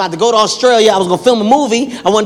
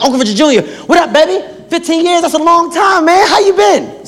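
high-pitched, excited reunion greeting; hyped greeting; 8 semitones higher than usual. Retold or imitated. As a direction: greet a long-lost uncle, all hyped up. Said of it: "I'm hyped to see him".

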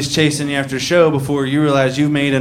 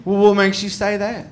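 put-on sarcastic drunk woman; 5 semitones higher than usual.

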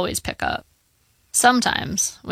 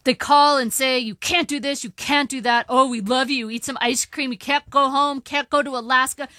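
high, worked-up complaint of an upset father; pitched-up, aggrieved old-dad tirade; agitated rant; 7 semitones higher than usual. Acted. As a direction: rant in a raised pitch, piling up grievances.

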